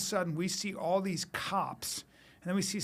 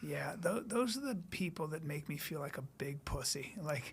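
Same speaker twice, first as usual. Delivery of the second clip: softer hushed admiring whisper; 3 semitones lower, 9 dB quieter than usual. Real, not acted. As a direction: whisper it back in awe. Hushed reply about heist.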